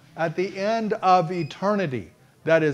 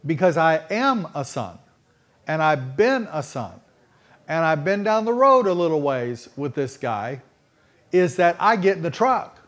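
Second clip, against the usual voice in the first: ordinary talk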